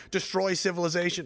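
ordinary talk, nothing marked

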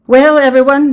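high, loud announcement; addressing the crowd. A narrator's reading. Official addresses the villagers, loud. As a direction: get the whole crowd's attention.

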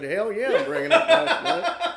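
high, laughing gleeful reply; mock gleeful holler; gleeful shout. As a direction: shout it with glee. Speaker's gleeful reply retold.

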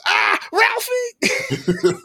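high, very slow shriek of a horrified mother; shocked mom. Retold or imitated. Podcaster imitating mom hearing the swear word, high and slow. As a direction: wail his name like a scandalized mom. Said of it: "she goes".

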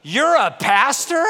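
stunned by the news about his skate buddy; shocked question. Retold or imitated. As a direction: tough skater friend, stunned at learning he's a pastor. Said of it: "the guy just looked at me and goes".

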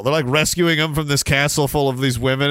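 ordinary delivery

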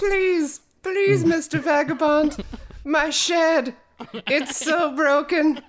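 very high, loud, hammed-up begging; pleading villager. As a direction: beg like a helpless little townsperson, mock-desperate. Acting a villager begging the vagabond.

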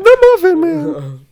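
high, slow squeaky cookie voice; gingerbread man voice. Retold or imitated. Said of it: "he sounds more like the gingerbread man".

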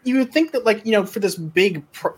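ordinary delivery

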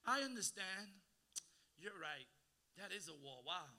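mock pity. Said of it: "God never goes".

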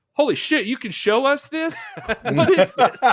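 high, loud disbelief breaking into laughter; mock astonished prisoners. Prisoners reacting to the movie shown in jail, amused astonishment. Acts out prisoners watching the film.

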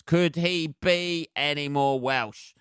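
ordinary talk

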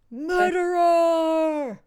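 long, drawn-out accusing wail; loud, high cry stretched right out; mock horrified cry. Book lover accusing those who cut books, mock-horrified wail.

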